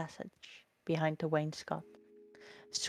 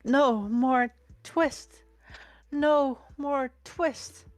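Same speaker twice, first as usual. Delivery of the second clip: higher, louder, slow delirious cry; feverish dream cry; 7 semitones higher, 6 dB louder, much slower than usual. A narrator's reading. The sick tailor crying out in his sleep, slow feverish cry. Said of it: "in his dreams he mumbled".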